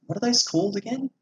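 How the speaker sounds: normal talking voice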